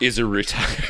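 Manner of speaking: mock trailer narrator cracking up; trailer voiceover. Podcaster spoofing a film trailer, failing to keep it up. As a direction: try a trailer voice, losing it to laughter. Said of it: "I couldn't even keep it up".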